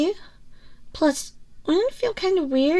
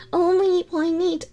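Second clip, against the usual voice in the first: ordinary talk, nothing marked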